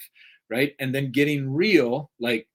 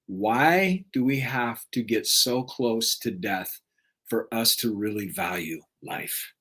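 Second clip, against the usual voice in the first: slowed, lowered, thoughtful; slow reflective question; slower than usual. Real, not acted. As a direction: ask it slowly to yourself. Repeated profound question.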